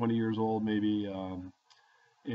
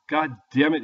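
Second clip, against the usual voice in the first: higher, louder exasperated curse from the cop; hammed-up cop cursing; retold cop swearing, louder and higher; mock angry cop; 5 semitones higher, 6 dB louder than usual. Policeman finds more beer.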